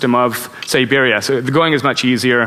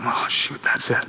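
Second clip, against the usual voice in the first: dismayed under breath; 3 semitones lower, 9 dB quieter, slower than usual. Retold or imitated. Realises tire is flat.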